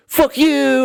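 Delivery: high, drawn-out mock yell; imitated angry parting shout. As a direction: hurl it as you storm off. Retold parting insult of attacker.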